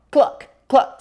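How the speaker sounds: high, squeaky mock cluck; reading to a child, the cow clucks very high. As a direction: cluck it out like a silly hen sound in a storybook cow voice. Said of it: "said cow".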